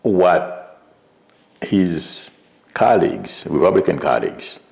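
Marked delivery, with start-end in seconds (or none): none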